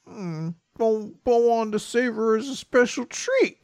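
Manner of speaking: lightly voiced hungry ghost; ghost voicing. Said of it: "And Ghost says".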